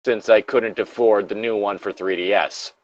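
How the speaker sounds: ordinary talk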